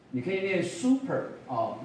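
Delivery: ordinary talk, nothing marked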